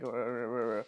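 deep croaky rah; low sick voice. Shown as a demonstration. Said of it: "Well, it's just low".

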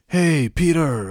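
drawn-out, high, loud hammed-up greeting; wrong manager voice. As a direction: overdo the manager's hello, stretched long and high. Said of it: "doesn't speak like that".

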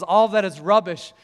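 loud, emphatic preaching; fired-up preacher quoting scripture; preacher mode. Retold or imitated. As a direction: declare it from the pulpit. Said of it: "in full preacher mode now".

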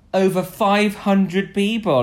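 mock-important boast; mock-impressive announcement. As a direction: announce the figure grandly, spacing it out. Retells proud charity claim.

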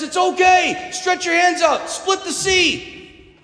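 God shouting a calm order; commanding God. Retold or imitated. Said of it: "And God says".

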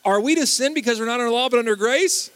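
very loud, high-pitched recital of the verse; wholehearted recitation. Shown as a demonstration. Preacher rallying the congregation to recite together, wholeheartedly. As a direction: belt it out so everyone joins in. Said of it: "Let's try it all together one more time".

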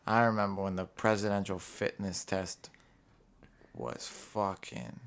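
low, soft wistful aside; quiet reminiscing. Acted. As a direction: murmur it like a memory.

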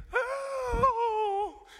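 a hammed-up, very high and very loud cry of "Oh!"; mock joyful cry. Mocking Merry and Pippin's cheesy reunion, a hammed-up joyful cry. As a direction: overdo a gushing movie cry. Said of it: "Merry and Pippin are like".

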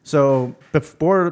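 ordinary talk

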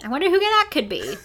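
high, loud singsong wondering; mock wondering.

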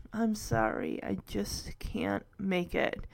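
low, quiet resignation; sinking, apologetic murmur; defeated apology. Acted. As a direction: give up softly, spent. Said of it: "where he is defeated".

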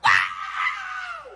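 long, high-pitched mock scream; imitation of wild sports cheering; mimicked fan's scream. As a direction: scream like a fan after a touchdown. Mimics fans at a touchdown.

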